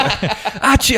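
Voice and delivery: high mock sneeze, full volume; sneeze imitation. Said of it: "walking away ... fainter".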